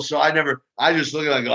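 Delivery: ordinary talk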